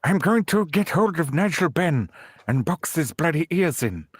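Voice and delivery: lisping boxer voice, half-failed Mandela; a Mandela attempt that slides into Chris Eubank; Chris Eubank impression. Mimicking a boxer while trying to do Mandela. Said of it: "I sound like fucking Chris Eubank".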